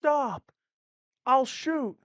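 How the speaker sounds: high, loud warning; shaky threat. Acted. Girl threatening to shoot the outlaw, high and loud. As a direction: hold them off, voice high and strained.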